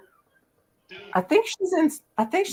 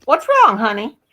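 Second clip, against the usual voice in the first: concerned cooing. Real, not acted. Asking an upset friend what's wrong.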